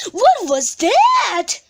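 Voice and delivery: startled. A narrator's reading. A crash in the dark.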